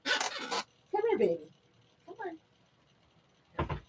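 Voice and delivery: very high, soft pet-calling voice; coaxing the dog. Real, not acted. Streamer calls her dog baby, high soft coaxing. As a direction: call the puppy softly, way up high.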